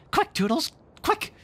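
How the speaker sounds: very high, urgent fairy shriek; screaming fairy. Acted. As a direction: hurry Toodles on. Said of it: "she screamed".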